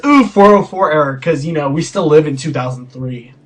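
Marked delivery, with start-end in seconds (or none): 0.0-1.2 sarcastic "ooh" at a dumb joke; louder mock-impressed put-down; mocking fake awe. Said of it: "it's just a dumb joke".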